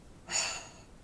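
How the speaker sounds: long, breathy sigh; exasperated sigh. Real, not acted. Fed up with a repeated advert, exasperated sigh.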